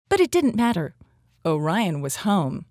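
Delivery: ordinary delivery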